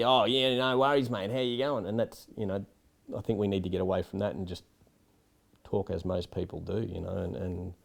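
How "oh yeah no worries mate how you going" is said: high, loud, hammed-up Australian twang; over-the-top ocker Australian accent, pitched up; exaggerated Aussie accent. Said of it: "their perception of how Australians sound".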